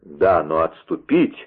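plain reading voice

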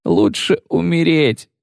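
high, slow pleading shout; desperate cry. Acted. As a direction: cry out that you'd rather die. Wounded man refuses amputation.

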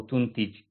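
تنتج as "tuntij", with u then i; second wrong form demo. Shown as a demonstration. Teacher rejecting another misreading, تنتج mispronounced tuntij. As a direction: say تنتج wrongly as tun-tij. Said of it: "لا".

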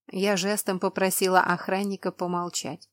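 usual speaking voice

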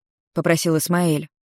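ordinary delivery, nothing marked

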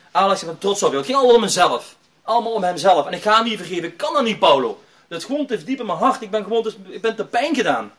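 retold hurt outburst. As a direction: act out a wounded son refusing to forgive. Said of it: "toen kwam het allemaal eruit".

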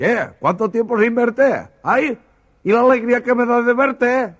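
excited greeting. Acted. Valencian from la Ribera greeting a friend in the street, overjoyed. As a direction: greet an old friend with big delight. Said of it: "Imagínense este valenciano de la Ribera".

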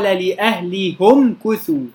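لأهلهم كثوا recited slowly with the ha as hu; Hamza's reading; damma reading. Shown as a demonstration. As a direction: say لأهلهم as li-ahlihumu. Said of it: "بضم الهاء".

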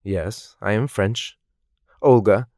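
ordinary delivery

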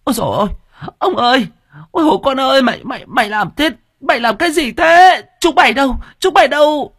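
put-on very high, loud panicked old woman's shriek. A narrator's reading. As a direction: shriek for help as the son attacks his father. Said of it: "Mộ Phụng thì rú lên".

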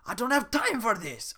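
high, loud impatient refusal; loud brush-off. Acted. Shrek brushing off Donkey, loud and impatient. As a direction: wave him off, fed up.

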